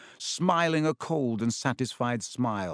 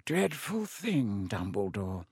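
lazy; 3 semitones lower, 5 dB quieter, slower than usual. A narrator's reading. Malfoy to Dumbledore about a dreadful thing, lazily. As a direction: let it trail down lazily, softly. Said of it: "said Malfoy lazily".

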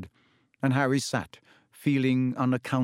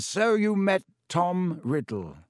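a lightly voiced old headmaster, musing; thoughtful Dumbledore; 7 semitones higher, slower than usual. A narrator's reading. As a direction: ponder it aloud, gently. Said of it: "said Dumbledore thoughtfully".

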